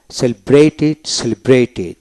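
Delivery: ordinary talk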